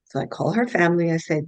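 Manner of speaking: usual speaking voice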